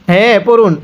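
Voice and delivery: ordinary talk, nothing marked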